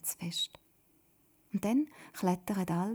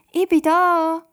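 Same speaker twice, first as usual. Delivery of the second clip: loud high call from the rocks; call from afar; 10 semitones higher, 15 dB louder, slower than usual. A narrator's reading. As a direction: call out from far off. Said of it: "tönt's jetzt wieder von den Felsen her".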